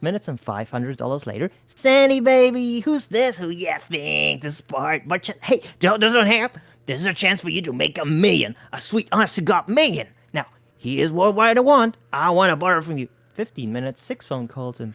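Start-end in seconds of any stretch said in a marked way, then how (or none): 1.7-13.1 lightly voiced hustler. A narrator's reading. Bart pitching to Sandy.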